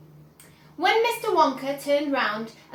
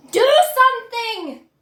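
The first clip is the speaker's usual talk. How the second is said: screaming at husband; 8 semitones higher, 9 dB louder than usual. Acted. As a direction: berate the husband. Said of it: "Missus Gloop screamed at".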